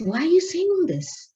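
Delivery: retold alarmed question, pitched high. Younger sister questions the elder's instructions, alarmed. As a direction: imitate a sister's high, distressed protest.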